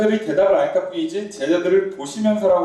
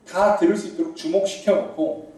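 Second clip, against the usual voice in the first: ordinary delivery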